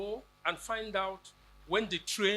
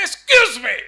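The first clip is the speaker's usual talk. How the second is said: a furious, far louder, higher excuse me; screamed; 6 semitones higher, 18 dB louder than usual. Retold or imitated. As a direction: scream it at the ticket man.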